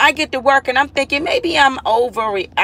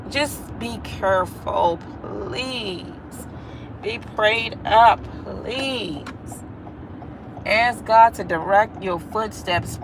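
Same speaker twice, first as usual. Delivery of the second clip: quiet, slow heartfelt pleading; soft plea; 5 dB quieter, slower than usual. Real, not acted. Pleading softly with viewers to be careful and prayed up. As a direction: gently beg them to be careful.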